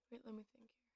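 whispered aside to herself. Real, not acted. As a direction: breathe it out, thinking aloud. Aside while recalling story.

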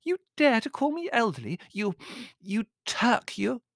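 high-pitched, spluttering fury; squeaky outrage. A narrator's reading. Countess scolding servant for insult, squeaky outrage. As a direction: splutter indignantly at the top of the voice. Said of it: "she squeaked".

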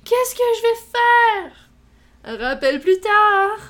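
very slow, high, loud panic; frantic shout. Retold or imitated. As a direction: cry out in mock panic. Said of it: "je suis comme".

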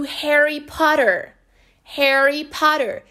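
"Harry Potter" slowly, double T tapped like R; drawn-out American "Harry Potter" with R ending; American flapped T. Shown as a demonstration. Teacher presenting a famous example, American flapped T. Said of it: "Um britânico falaria".

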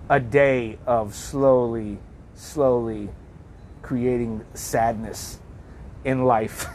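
drawn-out, weighty summing-up; unhurried, reflective delivery; slow, deliberate. Real, not acted. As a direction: let each word land, with long pauses. Sums up the day for effect.

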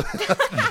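high burst of laughter; real bright laugh. Real, not acted. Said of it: "laughing at the reader".

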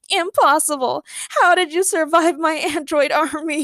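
high wobbling wail; yelping, starting to cry. A narrator's reading. Nur aghast that the heroes survived, tearful yelp. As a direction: wail it, breaking into mock sobs. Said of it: "he yelped as he started crying".

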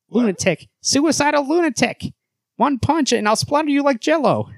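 high, indignant hero voice; Superman. Acted. Hero berating Batman, high indignant voice. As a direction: pitch it high, scolding and threatening. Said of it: "a higher voice for Superman".

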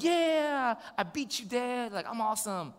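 put-on high, stretched cheer of a gloating kid; mock triumphant boast. Son boasting he beat dad, imitated triumph. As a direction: imitate a child crowing over a win.

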